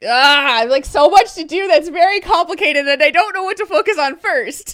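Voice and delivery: high, loud, exasperated burst; overwhelmed outburst. Real, not acted. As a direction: spill it out, overwhelmed and laughing.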